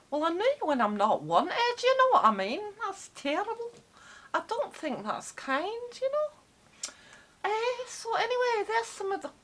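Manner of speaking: hammed-up huff in a Scottish accent; Scottish accent, comic indignation; offended Scottish. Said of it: "stop it".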